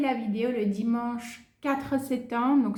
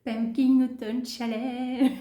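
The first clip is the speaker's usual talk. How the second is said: French-accented English. Real, not acted. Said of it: "Avec mon magnifique accent".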